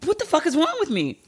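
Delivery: high, tearful, half laughing at herself; crying and baffled by her own tears; weeping. Real, not acted. Host still weeping after the [name] story, tearful disbelief. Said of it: "I'm, like, weeping".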